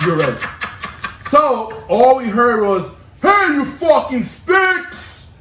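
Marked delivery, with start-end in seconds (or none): 3.2-5.3 high, drawn-out yell; mock angry shout. Skinheads hollering at the graffiti kids, imitated angry yell. Acts skinheads yelling at the group.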